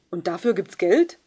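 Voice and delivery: put-on loud cheeky teen voice; a pupil heckles the new trainee teacher; called out. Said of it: "rief Kenans Banknachbar".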